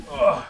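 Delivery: strained grunt of effort. Real, not acted. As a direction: grunt while forcing something hard. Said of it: "Sorry for all the grunting and groaning".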